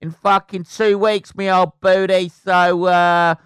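loud, high, cheeky mock Cockney taunt; mocking heckler voice. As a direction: bellow it like a cheeky geezer brushing off a dream. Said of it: "and somebody went".

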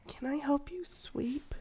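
breathy little whisper, slowed; soft whisper. Retold or imitated. A little girl offering help, whispered. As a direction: whisper it gently like a shy kid. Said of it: "she's like".

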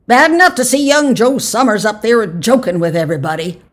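high, loud, cranky complaint; petulant. A narrator's reading. Old man grumbles about young Joe joking, petulant. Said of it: "he added petulantly".

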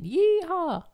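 high, loud cowboy whoop; cowboy yeehaw. Pretend. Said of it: "in your cowboy rodeo get up and you're saying".

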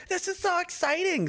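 mock new-recruit excitement. New soldier in a fresh uniform, gushing mock excitement. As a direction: play the giddy rookie on day one. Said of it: "You're like".

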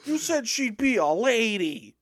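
high, loud whiny put-on voice; pretend Adam Driver. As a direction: whine like a sulking actor, drawn out. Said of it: "Adam, you have to get over this".

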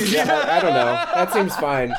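talking through laughter; giggling while answering; laughing. Real, not acted. Judge laughing at the joke.

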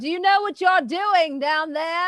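high, loud fretful wail; panicked cat. Acted. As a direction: yell up in alarm at the master. GM voices the cat.

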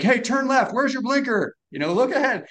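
high, eager dad calling out driving tips; dad coaching from outside. Retold or imitated. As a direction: play the anxious dad shouting tips beside the car. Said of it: "dad running next to the car".

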